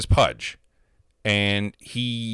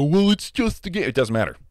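higher, louder whiny mockery; mocking whiny gamer; 6 semitones higher, 3 dB louder than usual. Mocking gamers who shrug off rage, whiny. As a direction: mimic a dismissive kid, whining. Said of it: "And they go".

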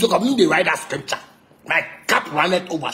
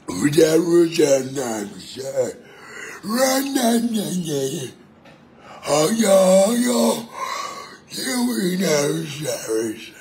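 mocking the musician; much slower than usual. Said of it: "he say the musician, so he go do something".

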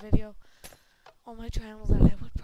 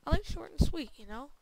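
usual speaking voice

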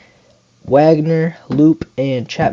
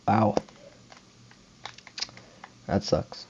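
whispered disappointment; 5 semitones lower, 10 dB quieter than usual. Real, not acted. Another popup out.

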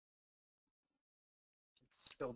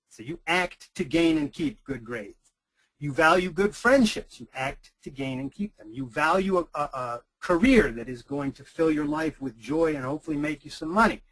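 ordinary delivery

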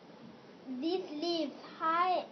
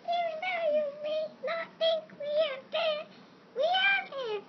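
much higher, squeaky little creature voice; tiny microbe voice; 10 semitones higher than usual. Acted. Microbes telling the reader they matter, high and squeaky. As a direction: speak like a tiny proud microbe.

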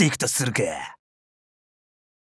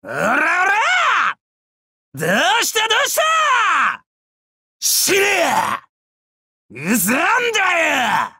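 battle attack shouts; 5 semitones higher than usual. Acted. Warrior attacking foes and telling them to die, savage yelling. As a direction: hurl each short cry like a blow.

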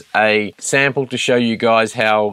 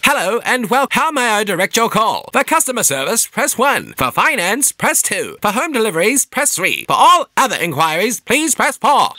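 much higher, bright polished menu read; phone menu voice; 11 semitones higher than usual. Shown as a demonstration. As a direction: sound like a cheerful recorded switchboard. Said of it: "we're gonna do this properly".

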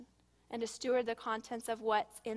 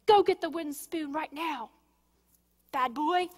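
put-on higher, louder angry order to the child; angry parent; 3 semitones higher, 5 dB louder than usual. Shown as a demonstration. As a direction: snap at the child in anger.

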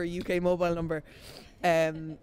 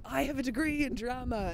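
mock-proud, higher-pitched new graduate; naive proud graduate; 7 semitones higher than usual. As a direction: announce it high and proudly. Acting naive new graduate announcing degree.

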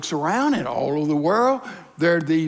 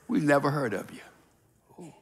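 slow, soft half-whisper, lower; hushed snub; 4 semitones lower, 6 dB quieter than usual. Acted. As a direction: dismiss him slowly under your breath. Heaven's voice snubs the emperor.

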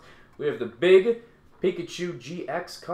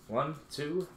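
ordinary talk

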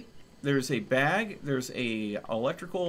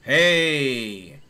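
calling out; 10 dB louder than usual. Real, not acted. Calling out found hole.

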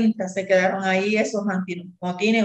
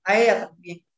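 ordinary talk

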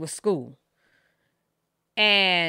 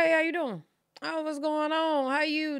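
fake friendly; 9 semitones higher than usual. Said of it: "Fake ass love".

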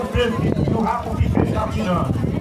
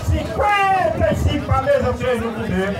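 higher, ringing bid calls; auctioneer call; 3 semitones higher, slower than usual. Real, not acted. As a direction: call out the new bid to the tables. Auctioneer raising bids.

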